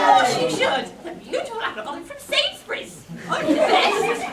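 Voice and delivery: loud laughing witch cry; cackling witch. Acted. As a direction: cackle through it.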